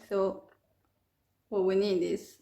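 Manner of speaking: ordinary delivery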